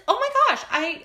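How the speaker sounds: high, loud squeal of recognition; excited squeal. Retold or imitated. Client recognizing her, squealing high and loud. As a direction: shriek with delight. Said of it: "She's like".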